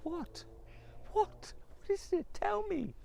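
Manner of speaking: high, urgent husband voice; alarmed husband. Acted. Husband demanding his wife explain, high and urgent. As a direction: press her to tell.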